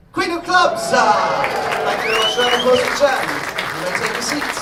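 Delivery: loud, high stage announcer; showman shout. Acted. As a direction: proclaim the reveal to the crowd. Reveal to the audience.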